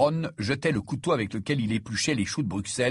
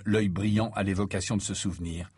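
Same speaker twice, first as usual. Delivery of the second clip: usual speaking voice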